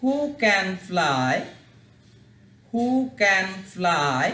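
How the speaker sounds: who can fly very slowly, who stressed; slow English drill. Shown as a demonstration. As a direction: spell out the phrase slowly for children. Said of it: "কাছ থেকে তোমাদের দেখায়". (ধীরে ধীরে ইংরেজি উচ্চারণ)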